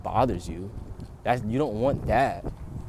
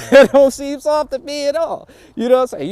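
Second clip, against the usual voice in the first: loud emphatic; 12 dB louder than usual. Real, not acted. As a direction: shout the punchline up high.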